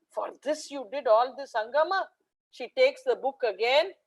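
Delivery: normal talking voice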